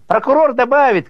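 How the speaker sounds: put-on very high, loud boyish yell; shrieked high-pitched kid's call; boy's shout. A narrator's reading. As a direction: holler it down from up high. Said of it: "кричит гопчик сверху".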